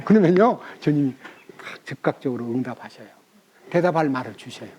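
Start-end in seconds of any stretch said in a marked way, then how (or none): none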